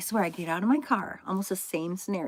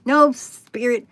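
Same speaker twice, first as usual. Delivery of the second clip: loud protest; 5 semitones higher, 10 dB louder, much slower than usual. Retold or imitated. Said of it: "And I'm like".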